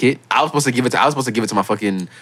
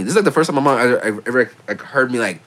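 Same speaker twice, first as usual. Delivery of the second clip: normal talking voice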